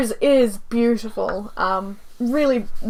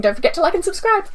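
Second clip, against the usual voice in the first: quick, higher-pitched sign-off; very fast; 7 semitones higher, faster than usual. Real, not acted. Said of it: "I said that very fast".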